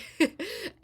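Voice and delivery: sharp, high burst of laughter. Real, not acted. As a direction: laugh at yourself. Laughing at her own denial.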